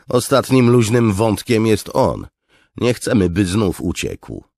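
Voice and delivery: high, loud, insistent voice; forceful officer. Acted. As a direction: press for the final killing.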